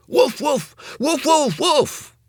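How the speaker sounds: high loud barking woofs. A narrator's reading. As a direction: bark like a small dog. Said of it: "it came out as".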